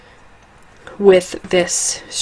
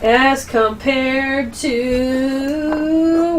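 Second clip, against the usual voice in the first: very slow, stretched suspense; drawn out; 5 semitones higher, 6 dB quieter, much slower than usual. Shown as a demonstration. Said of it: "wait for it".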